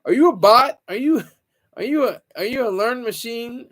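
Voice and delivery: mock suspicion broken by laughter; laughing tease. Real, not acted. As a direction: tease him through a laugh. Teasing a viewer as bot.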